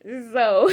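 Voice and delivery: high breathy laughter; high giggle. Real, not acted. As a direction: burst into giggles. Laughing at God's reply.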